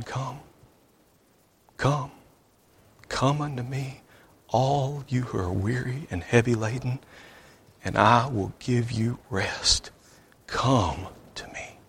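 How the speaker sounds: soft, hushed calling that fades into a whisper; gentle shepherd's voice. Acted. God calling the weary man to rest, a soft hushed plea. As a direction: coax them in tenderly, dying away to a breath. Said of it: "gentle voice of the shepherd".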